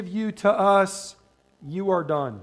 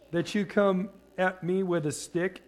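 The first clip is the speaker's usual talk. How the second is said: normal talking voice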